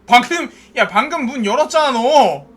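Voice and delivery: angry shout. Real, not acted. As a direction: yell at them for opening the door. Yelling at a game character.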